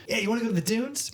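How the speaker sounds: mock the kid's laid-back chant up high; imitating Tyler.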